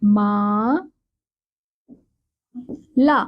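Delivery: मा ... ला spoken apart; syllable by syllable. Shown as a demonstration. Teacher to children, splitting the word. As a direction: sound out माला slowly. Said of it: "जैसे".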